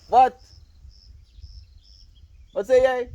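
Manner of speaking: a sudden loud high yelp of confusion; surprised. Real, not acted. Streamer baffled by a viewer's odd message, loud surprise. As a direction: jump up in disbelief at the word.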